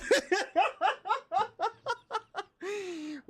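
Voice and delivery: high-pitched laugh. Real, not acted. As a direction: break into a squeaky laugh. Laughing at getting old.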